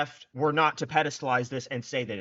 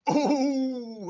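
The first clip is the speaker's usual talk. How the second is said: excited oh, much higher; high gleeful whoop; 12 semitones higher, 4 dB louder than usual. Real, not acted. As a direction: squeal with delight at the hit. Cheering a big hit.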